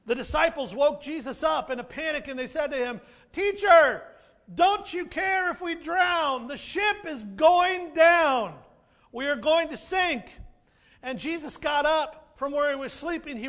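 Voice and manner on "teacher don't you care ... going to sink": loud, high, frantic plea; panicked cry. Retold or imitated. Disciples shout to Jesus in panic, loud and high. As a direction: cry out like drowning men.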